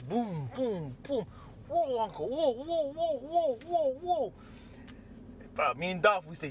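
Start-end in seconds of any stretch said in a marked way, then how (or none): 1.7-4.3 high, rhythmic retold alarm; alarmed protest. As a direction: act out trying to halt someone, pitched up. Acting out stopping uncle mid-rampage.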